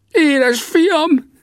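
extremely high, gushing exclamation; elated high-pitched shout; overjoyed cry. A narrator's reading. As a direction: squeal it with relief and delight. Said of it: "kiáltotta lelkendezve".

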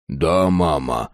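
low, slow dutiful reply; obedient son. A narrator's reading. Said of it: "ответил он".

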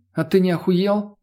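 lightly voiced defiance; rebellious. A narrator's reading. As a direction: push back with a touch of outrage. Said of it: "подхватила бунтарское настроение Лена".

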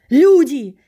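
very loud, high cry for help; full-force shout; desperate scream. A narrator's reading. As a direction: call out to the crowd with all your might. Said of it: "изо всех сил закричал я".

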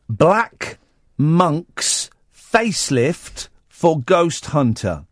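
slow, spaced-out headline reading; very careful. Real, not acted. Radio host reading a newspaper headline, very carefully. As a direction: read each word out carefully and slowly. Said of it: "I'm saying all that very carefully".

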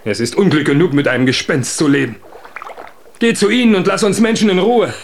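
loud, fast, high raging shout; furious outburst. Acted. Huldbrand curses Undine in a furious shout. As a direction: hurl the curse at her. Husband curses his spirit wife.

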